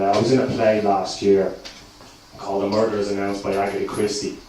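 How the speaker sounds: usual speaking voice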